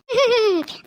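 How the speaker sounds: scornful laugh; a short jeering laugh at her; mother-in-law laughs off the daughter-in-law's plea; mocking laugh.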